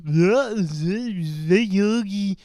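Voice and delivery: slow, hammed-up cartoon French accent; mock French accent for a silly cartoon cub; Boo Boo, mock French accent. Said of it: "And Boo Boo goes".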